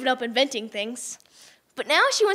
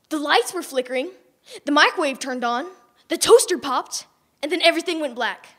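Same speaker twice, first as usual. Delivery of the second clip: plain reading voice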